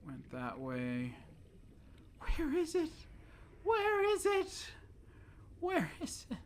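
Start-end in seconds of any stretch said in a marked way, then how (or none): 2.2-6.4 squeaky whine. Pretend. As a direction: whine like a lost kid. Frantic search again.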